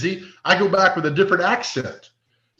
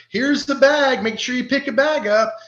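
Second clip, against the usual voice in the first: lightly higher, sweet helper voice; sweet lady voice; 8 semitones higher than usual. Retold or imitated. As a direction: chirp it like a friendly sample lady. Voicing the sweet sample lady.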